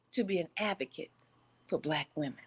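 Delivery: quiet, trailing off; said under the breath before the repeat; dropped low at the end; plain first take. Shown as a demonstration. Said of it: "let me say that one more again for the people in the back".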